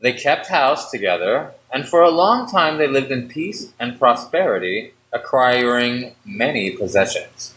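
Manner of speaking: low, measured fairy-tale reading; story narration. A narrator's reading. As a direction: read it like a bedtime story.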